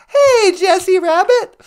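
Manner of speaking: chirpy robot sidekick offering a ride home; high, squeaky sidekick voice. Pretend. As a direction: squeak it like a tiny robot pal. Voicing the companion.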